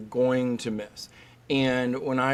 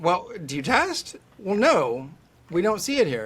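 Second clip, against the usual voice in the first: higher-pitched mock question and denial; mimicked exchange; 5 semitones higher than usual. Lecturer questioning doctors who never test, mock exchange. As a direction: replay the exchange with pointed naivety. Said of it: "I always get that question".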